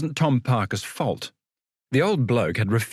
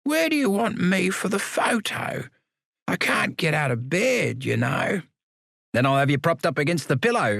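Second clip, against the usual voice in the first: put-on wry old convict, stretching out still alive; frail old man; 7 semitones higher than usual. Samuel asks about the photo.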